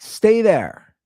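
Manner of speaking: put-on dad yelling to toddler to stay put; yelling back. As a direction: shout it to the kid below. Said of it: "I just keep yelling back".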